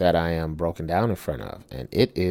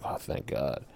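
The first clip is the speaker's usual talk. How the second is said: breathless whisper of relief; softly whispered thank God; relieved whisper; 6 semitones lower, 13 dB quieter than usual. Retold or imitated. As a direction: sigh it out, barely voiced. Relief when rescued.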